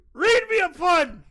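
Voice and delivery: put-on high, loud, slow drunken yell; drunken shout. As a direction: bellow it like a drunk. Drunk shout demanding a pun.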